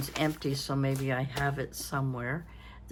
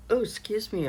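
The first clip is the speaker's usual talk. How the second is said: ordinary talk, nothing marked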